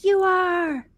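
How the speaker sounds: high, slow, adoring fan voices; gushing fans. Retold or imitated. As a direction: cheer her on like a devoted fan. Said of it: "And they're like".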